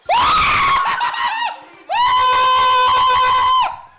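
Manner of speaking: very high, loud shrieking; long, wild shriek; full-force scream. Real, not acted. Gamer as the shot nearly fails, full-force shriek.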